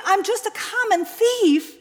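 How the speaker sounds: high, loud, falling in defeat; despairing confession. Acted. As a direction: let it sink with finality.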